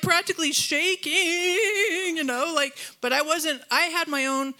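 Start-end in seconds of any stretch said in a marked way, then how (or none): 0.0-2.2 high-pitched, stretching out the last word; acted-out agitation. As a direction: relive being rattled, drawing out "shaking". Acts out being shaken up.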